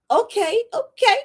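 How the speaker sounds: okay okay up high and bright; a sudden high double okay of relief; relieved okay. Retold or imitated. Reacting to being saved.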